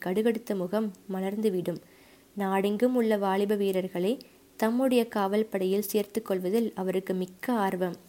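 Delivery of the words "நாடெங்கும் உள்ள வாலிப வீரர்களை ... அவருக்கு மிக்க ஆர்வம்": plain reading voice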